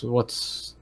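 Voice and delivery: usual speaking voice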